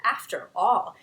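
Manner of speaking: plain reading voice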